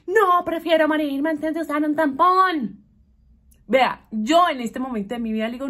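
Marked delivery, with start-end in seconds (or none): 0.1-2.8 a hint of a loud, high teen; mildly hammed-up teen horror; dramatic teen refusal. Daughter refusing a tampon, in mild mock horror. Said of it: "no sé, ¿qué va a hacer?".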